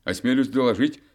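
loud, crisp soldier report; reporting smartly. Acted. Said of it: "отрапортовал год".

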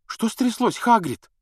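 put-on high, alarmed girl's question; frightened question. A narrator's reading. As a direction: ask in alarm. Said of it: "спросила испуганно Гермиона".

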